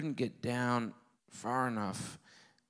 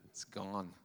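ordinary talk

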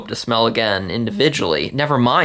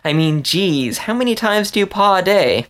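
much higher, mock-disgusted ribbing; teasing exasperation; 9 semitones higher than usual. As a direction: needle the roommate with a wrinkled nose. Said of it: "she said, wrinkling her nose".

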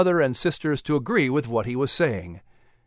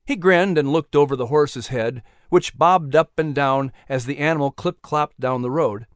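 plain reading voice

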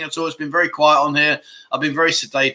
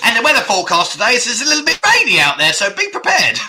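higher, louder, fast weatherman voice; mock weather forecast; 8 semitones higher, 5 dB louder than usual. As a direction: ham up a TV weather forecast, quickly. Performing a weather forecast bit.

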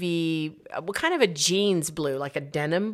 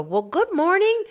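much higher, cheery greeting; a light imitation of a confused old man's cheery hello; 13 semitones higher, 5 dB louder than usual. Confused old James greeting them at dusk, cheery.

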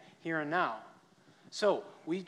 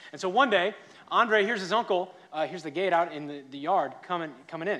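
louder and higher, building the suspense; excited storytelling; 4 semitones higher, 10 dB louder than usual. Acted. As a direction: tell it loud and eager, the big moment is coming.

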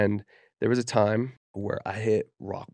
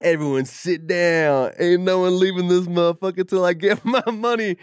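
loud, high mock-gangster command; hammed-up gangster shout, breaking into a laugh; movie gangster; 8 semitones higher, 9 dB louder than usual. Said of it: "I've seen some gangster movies".

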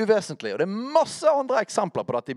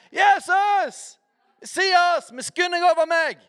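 blind man crying out; 11 semitones higher, slower than usual. Retold or imitated. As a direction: call out to stop Jesus passing by. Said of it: "Husker dere han som ropte".

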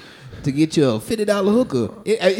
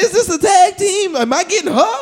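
pitched-up bewildered questions; high confused panic; 12 semitones higher, 5 dB louder than usual. Retold or imitated. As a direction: ask it high and lost. Confused newcomer in motel.

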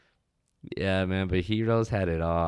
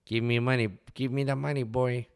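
Tola; 6 semitones higher than usual. Shown as a demonstration. Man retries the restaurant owner's voice, high and demanding. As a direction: put on an older local character's demanding voice, pitched up. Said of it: "do a better Tola voice".

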